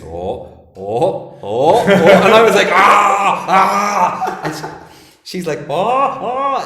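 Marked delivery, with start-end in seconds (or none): 0.0-1.8 rising mock wails, louder each time; hammed-up "oh" cries building to a yell; mimicked wailing. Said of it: "she was like this". 2.7-4.4 high, loud hammed-up scream; retold yell of pain; mock scream. Retold yelling during drain removal. 5.7-6.6 high, loud mock wail; hammed-up imitation of pained cries; mimicking cries. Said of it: "she's mimicking me".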